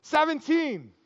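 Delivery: loud high hopeful shout; new guy takes his shot, loud and high; yelled number. Retold or imitated. New guy tries the joke number, loud high shout. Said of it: "he yells out".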